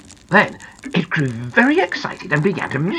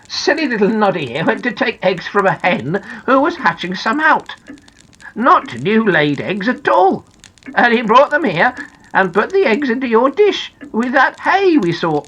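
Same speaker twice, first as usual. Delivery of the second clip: lively, higher-pitched storybook character; Little Ears, amused; 5 semitones higher than usual. Acted. Little Ears explains the mistake to Big Ears, lively. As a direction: explain the mix-up with lifted, animated emphasis.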